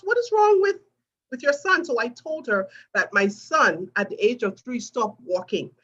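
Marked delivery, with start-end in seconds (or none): 0.0-1.8 high, raised voice for the manager's question; mock-curious manager asking, higher and louder; quoting the sales manager. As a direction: lift the voice up high to ask it.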